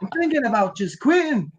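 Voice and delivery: hammed-up Scottish groundskeeper complaining; aggrieved Scottish workman; Scottish accent. Shown as a demonstration.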